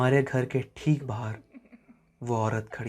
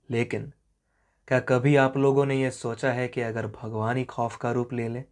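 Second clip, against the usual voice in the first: ordinary delivery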